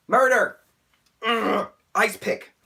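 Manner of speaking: loud, high burst of rage; over-the-top angry exclamations; furious outburst. Real, not acted. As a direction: spit out murderous threats in exasperation. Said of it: "I'm, I'm, s-, I'm so furious".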